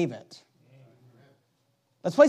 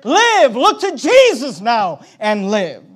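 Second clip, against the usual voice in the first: exhorting cry; 6 dB louder, slower than usual. Acted. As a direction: cry out, then settle on the last words. Preacher cries out the hymn's appeal.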